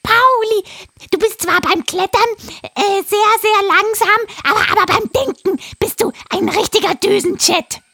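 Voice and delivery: high, loud parrot voice; a frantic parrot urging; excited parrot. Acted. Parrot voice held. (hohe, aufgeregte Papageienstimme)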